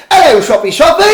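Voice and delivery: cheery British greeting, high and loud; British accent. Retold or imitated. Greeting his workshop each morning in a British accent. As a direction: hail the shop like a chirpy Brit. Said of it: "I say hi to the shop in a, in a British accent".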